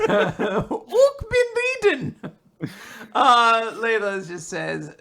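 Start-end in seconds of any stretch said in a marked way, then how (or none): 0.1-2.1 high, loud fake German; terrible German accent. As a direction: drag it out in hammed-up bad German. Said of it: "Just the worst German".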